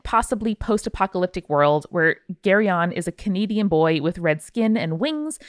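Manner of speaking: ordinary delivery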